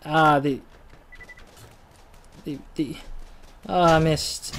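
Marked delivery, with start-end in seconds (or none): none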